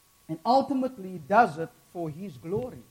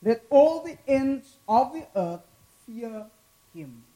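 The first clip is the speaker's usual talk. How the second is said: loud, rising scripture reading; dramatic scripture reading; 5 semitones higher, 8 dB louder than usual. Acted. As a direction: proclaim the psalm to the congregation. Reads the psalm aloud with force.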